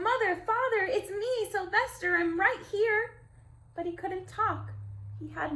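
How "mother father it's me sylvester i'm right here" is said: high, loud calling out; desperate shout. Acted. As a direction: shout for Mother and Father to hear. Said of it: "How he wanted to shout".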